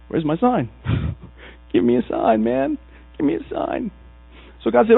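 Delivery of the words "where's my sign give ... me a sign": high, softer plea with a laugh in it; mock pleading. Abram asking God for a sign, playful high pleading. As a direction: beg playfully, pitch climbing.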